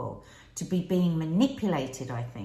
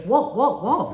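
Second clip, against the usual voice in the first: high, louder mock startle; startled recoil; 4 semitones higher, 8 dB louder, slower than usual. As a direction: act out backing off from a touch, pitch jumping up. Acting out startled recoil from touch.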